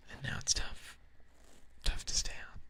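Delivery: breathy, near-whispered relaxed talk; sleepy, whispered ASMR-style chat; hushed whispering. Real, not acted. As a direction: murmur it close, with long pauses. Quiet, rambling whispered talk.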